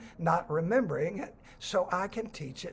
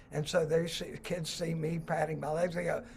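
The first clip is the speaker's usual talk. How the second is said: ordinary talk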